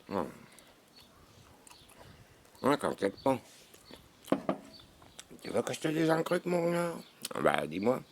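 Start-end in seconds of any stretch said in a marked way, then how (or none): 5.4-6.9 a high, teasing sing-song; playful high voice. Acted. High teasing voice to his friend about the week. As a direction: pitch it way up, teasing.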